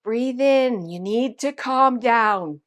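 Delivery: imitating a deliberate, drawn-out "calm down"; slow calming instruction. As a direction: coach her to breathe, very slowly. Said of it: "And he's like".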